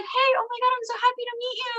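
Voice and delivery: high, eager gushing voice; overexcited greeting. Retold or imitated. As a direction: gush like you're thrilled to meet. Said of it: "he's like".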